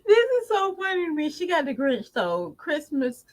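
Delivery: high voice breaking with laughter; giggling through the words; laughing. Real, not acted. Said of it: "I can't stop laughing at it".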